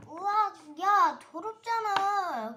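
loud, slow, disgusted outcry; disgusted yell. Pretend. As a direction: shout in mock disgust at the spit. 아이가 인형 역할로 더럽다고 외침. (역겹다는 듯 크게 외침)

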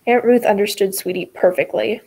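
ordinary delivery, nothing marked

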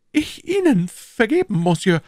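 high, loud, flustered stammer. Acted. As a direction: stumble over disbelief. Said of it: "stammelte Bonacieux".

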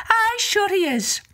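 high, lilting Irish wife; Una's voice. Acted. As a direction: sweetly put off the visitor in a high voice. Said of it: "Una said".

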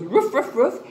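three short, playful woofs; dog barking. Shown as a demonstration. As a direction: bark like a dog for children. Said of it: "a dog goes".

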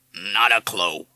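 grave, loud parrot echo. A narrator's reading. Parrot echoing the father's words, grave. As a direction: repeat it solemnly as a parrot. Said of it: "said Madison gravely".

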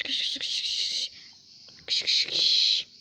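slow, breathy whispered urging; urgent whisper. Real, not acted. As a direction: hiss the order to run. Urging teammates to run. (fısıltıyla acil uyarı)

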